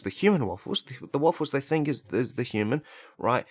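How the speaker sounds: usual speaking voice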